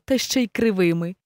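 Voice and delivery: ordinary delivery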